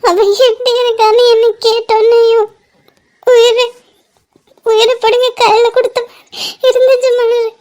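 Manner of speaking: halting, tearful confession between sobs; sobbing. Acted. Confiding heartbreak to friend Malar, sobbing uncontrollably. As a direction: unable to control the crying, words broken by gasps. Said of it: "ஏன் இப்படி தேம்பி தேம்பி அழுற".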